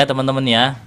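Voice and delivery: normal talking voice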